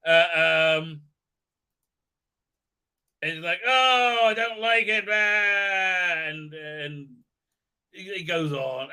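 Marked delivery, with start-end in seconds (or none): 3.7-6.2 high, drawn-out mock complaint; mock whining. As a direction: moan it like a hammed-up whiner. Voicing complaining character after waking.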